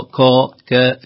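first "كا" dark, second "كا" thin; heavy then light kāf. Shown as a demonstration. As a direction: contrast "كا" thick, then "كا" fronted. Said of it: "نرقق الكاف". («كا» مفخمة ثم مرققة)

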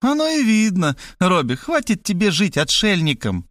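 put-on high, reproachful old mother; mother's nagging voice. A narrator's reading. Mother scolding son at the door, high and reproachful. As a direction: scold him like a fussy mother.

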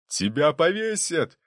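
high, slow, drawn-out outburst; angry threat. A narrator's reading. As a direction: call out the threat from the gallery. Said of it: "снова крикнул князь Трубецкой". (гневная угроза, протяжно и высоко)

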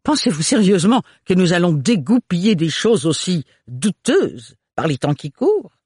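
high, indignant aristocrat voice; scornful baron. A narrator's reading. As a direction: scoff at it like a haughty baron. Baron scoffing at the hourglasses.

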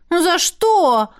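high, drawn-out mild wail; wailing protest. A narrator's reading. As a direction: whine it as an aggrieved protest. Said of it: "взвыла я". (протестующий вой, жалобно)

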